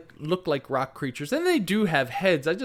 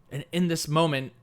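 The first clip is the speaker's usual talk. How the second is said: ordinary delivery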